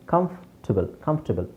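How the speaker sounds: ಕಂಫರ್ಟಬಲ್ shortened to kamf-ti-bal; correct pronunciation. Shown as a demonstration. Teacher correcting a common mistake, correct pronunciation. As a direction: drop the o and r in ಕಂಫರ್ಟಬಲ್. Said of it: "ಓ ಮತ್ತು ಆರ್ ಎರಡೂ silent".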